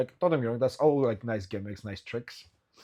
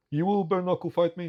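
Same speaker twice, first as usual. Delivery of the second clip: lightly voiced big fighter, pitched higher and louder; a hint of the fighter's challenge, higher up; Sean O'Hagan; 7 semitones higher, 5 dB louder than usual. Recalling the giant's challenge, higher and louder. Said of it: "He said to me".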